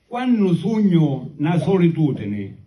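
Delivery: slow declaimed poem in Sicilian; sicilian accent. Acted. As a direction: recite the verses slowly in Sicilian. Recites his second Sicilian poem.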